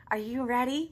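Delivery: plain reading voice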